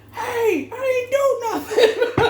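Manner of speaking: high, laughing, squeaky plea of innocence; panicked protest. Pretend. Shopper caught in a protest, high panicked laughing plea. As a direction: squeal it like you're caught for nothing. Innocent person suddenly in trouble.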